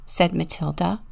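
plain reading voice

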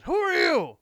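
loud high shout of "Who are you?"; shouted demand. Retold or imitated. Homeowner at the door yelling at a stranger, loud shout. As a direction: bark it out like a startled homeowner. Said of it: "this guy's head pops out ... and says".

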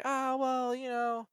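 high, loud bargaining voice; mock pleading debtor. Imitating debtors bargaining for their car.

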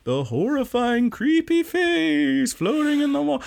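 high, slow mock-ghostly wail; spooky voice. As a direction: ham up the haunted house. Mock-spooky describing the face.

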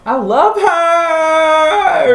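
high, slow drawn-out squeal; excited squeal. Real, not acted. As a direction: squeal like a starstruck fan. Fan squeal about [name].

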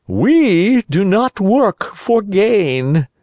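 high, slow, sly fox; sly fox warning. Acted. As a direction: warn him slowly, sweetly threatening. Fox feigns virtue.

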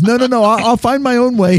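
nervous American, high and hurried; panicked high retreat. Retold or imitated. A tourist hearing a threat, backing off in panic. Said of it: "and he, he goes".

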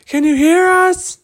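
very high, loud searching holler; yelling. Acted. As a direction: yell out for the missing at full stretch. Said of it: "they yelled one more time".

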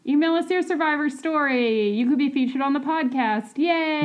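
high-pitched promo cheer; peppy show plug. Real, not acted. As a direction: pitch it brightly to listeners, ending in a cheer. Host plugging the show.

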